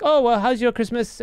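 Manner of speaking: high, lilting Filipino accent, imitating the agent; chirpy Filipino call-center voice; Filipino accent impression. Filipino agent making small talk with the caller, in a high Filipino accent. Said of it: "an impression of a Filipino lady".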